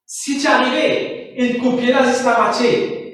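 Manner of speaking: ordinary delivery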